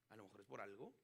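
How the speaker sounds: ordinary talk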